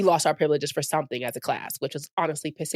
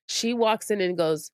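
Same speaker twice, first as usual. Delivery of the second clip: ordinary delivery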